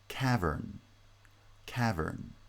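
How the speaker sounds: "cavern" falling from a stressed first syllable. Shown as a demonstration. Lecturer listing example nouns, initial stress on "cavern". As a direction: stress the start of "cavern", let the end drop. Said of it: "tend to have initial stress".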